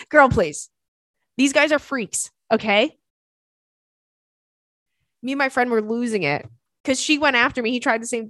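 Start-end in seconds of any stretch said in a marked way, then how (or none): none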